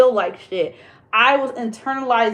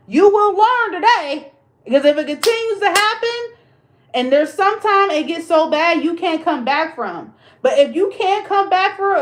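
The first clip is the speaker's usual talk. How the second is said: fired-up warning; 6 semitones higher than usual. Acted. Lecturing viewers who keep letting people in, fired-up warning. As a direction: rise up and warn them hard.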